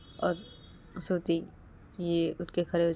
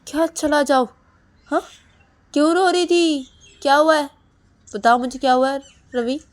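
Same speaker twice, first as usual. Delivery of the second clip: much higher, loud hammering questions; angry demand; 8 semitones higher, 12 dB louder than usual. Acted. Husband demanding why his wife cried, loud and angry. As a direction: keep demanding louder each time.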